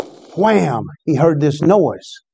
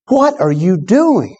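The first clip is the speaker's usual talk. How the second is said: put-on slow, higher, demanding what the boy did; angry demand; 5 semitones higher, slower than usual. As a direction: confront the boy, drawn out and sharp. Angry businessman grabs the boy.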